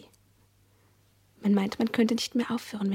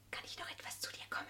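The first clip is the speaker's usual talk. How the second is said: hushed night-time whisper; 17 dB quieter, faster than usual. Acted. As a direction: whisper so nobody wakes. Child sneaking to sibling's bed.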